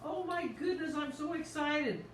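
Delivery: excited. Real, not acted. Excited about making the dish.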